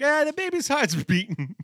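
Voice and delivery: high, loud mock insult-comic; hammed-up Don Rickles; Don Rickles impression. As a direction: bark it like a brassy old comic. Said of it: "Don Rickles machine".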